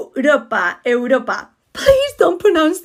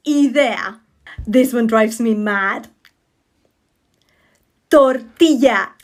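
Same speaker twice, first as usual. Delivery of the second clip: ordinary talk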